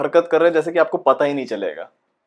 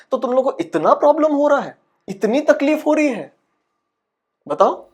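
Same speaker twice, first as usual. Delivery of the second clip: high-pitched, indignant complaint; exasperated challenge; 9 semitones higher than usual. Acted. Aspiring actor confronts those troubling him, exasperated and high.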